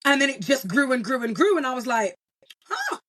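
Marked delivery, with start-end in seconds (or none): 2.7-3.0 very high, soft little huh; a small high noise of wonder; quietly taken aback, pitched way up; pleasant surprise. Retold or imitated. Retells her surprise at growth.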